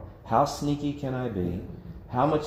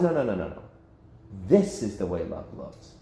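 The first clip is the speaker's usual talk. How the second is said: lower, firm and settled; firm correction; 4 semitones lower than usual. Pretend. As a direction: set the record straight, calmly. God corrects the world.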